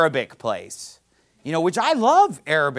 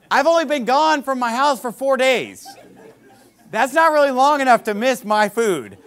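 laughing reply; 9 semitones higher, 4 dB louder than usual. Retold or imitated. Amused retelling to host.

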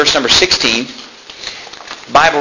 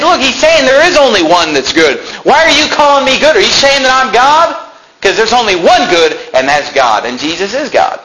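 forceful argument; 9 semitones higher than usual. Retold or imitated. Preacher retells arguing his point.

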